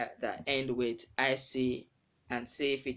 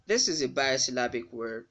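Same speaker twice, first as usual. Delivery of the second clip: usual speaking voice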